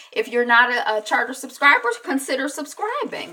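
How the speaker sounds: ordinary talk, nothing marked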